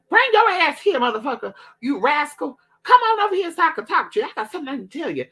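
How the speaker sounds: high, loud, fast scolding auntie; scary auntie voice. Retold or imitated. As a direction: screech it fast like a scary aunt. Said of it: "she'd be like".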